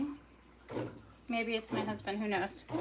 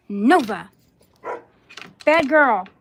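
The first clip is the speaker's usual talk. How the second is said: loud, stern dog scolding; 15 dB louder, slower than usual. Real, not acted. Owner to dog after squirrels, loud scolding. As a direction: scold a barking dog at full voice.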